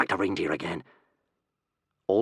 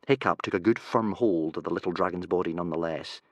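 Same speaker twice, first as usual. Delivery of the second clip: ordinary delivery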